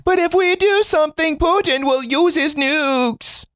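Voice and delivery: cartoonishly high put-on worry; mock fretting objector. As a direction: mimic a fearful naysayer in a squeaky, held-up pitch. Mocks fearful objection to acting.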